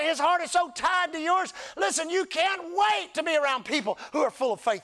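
high, loud, rising sermon cadence; full-force preaching. Acted. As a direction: preach it out to the crowd.

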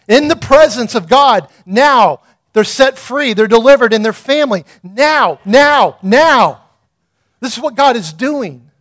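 loud, rising chant of now, now, now; fiery preaching. Acted. Pastor proclaiming revival to the church, loud and rising.

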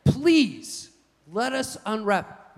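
put-on high, drawn-out kid's plea; begging. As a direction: act out a child pleading with dad. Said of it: "I would beg my dad".

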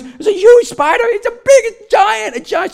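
very high, loud mock panic; imitated flipping out. Wife reporting a huge spider to her husband, flipping out. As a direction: squeal it like someone terrified of a spider. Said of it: "she's flipping out".

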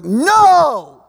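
loud teacher's correction; booming correction; emphatic no. Retold or imitated. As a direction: bark a sharp "no" at the class. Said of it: "And he was like".

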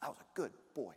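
mock good husband boast. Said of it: "I don't go".

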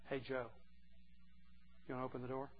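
soft, gentle inviting call; welcoming voice. Pretend. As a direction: coax each man kindly to the door. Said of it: "It's not an angry voice. It's a voice that's a welcoming voice".

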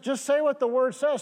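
normal talking voice

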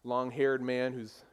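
normal talking voice

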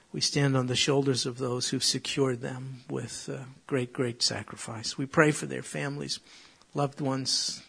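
soft, hushed prayer voice. Acted. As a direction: pray quietly and earnestly before the congregation. Opening prayer before sermon.

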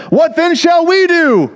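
high, hammed-up panicked crowd; worried crowd pleading for an answer; freaked out. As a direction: cry out the question in mock alarm. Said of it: "were kind of freaked out".

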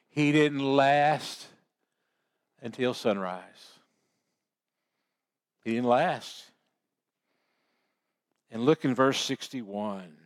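ordinary talk, nothing marked

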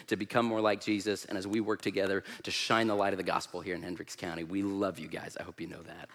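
soft, low, heartfelt affirmation; sincere encouragement. Real, not acted. Guest minister tells host church they are loved, softly sincere.

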